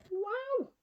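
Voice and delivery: high breathless wow; awed little wow. Acted. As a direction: gasp in wonder at the horses. Horses appear.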